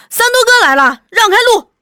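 high, loud call to clear a path; shouting. A narrator's reading. Crowd announcing Sanduo's arrival, shouting. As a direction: holler over a crowd. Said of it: "大家嚷着".

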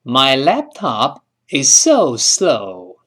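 slow, clear teacher read; careful slow English demo; slow model sentence. Shown as a demonstration. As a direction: say each word slowly for students. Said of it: "我这笔记本电脑现在特别慢".

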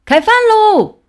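high, loud dinner call; shouting. A narrator's reading. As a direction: call everyone to eat at the top of your voice. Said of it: "只听熊爸爸喊".

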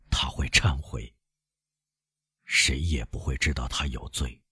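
low, soft musing to himself; subdued brooding. A narrator's reading. Villefort reasons about his wife's guilt, subdued. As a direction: quietly talk himself into it.

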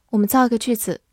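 ordinary talk, nothing marked

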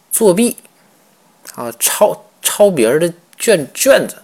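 high, loud indignant cry of discovery; shocked outburst. Retold or imitated. Emperor catching the examinee cheating, shocked loud outcry. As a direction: blurt it out in outrage at catching a cheat.

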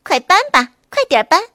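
the bunny pushing the wolf to work; urging rabbit. A narrator's reading. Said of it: "小灰兔催着大灰狼".

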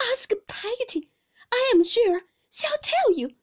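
high, loud, desperate insistence; piteous imploring. A narrator's reading. Mother insisting to her husband she is affectionate, piteous and high. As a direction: plead pitifully at the top of the voice range. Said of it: "very piteously".

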